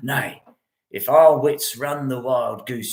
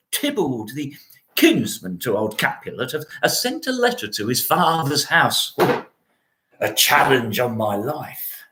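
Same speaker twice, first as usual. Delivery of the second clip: ordinary talk, nothing marked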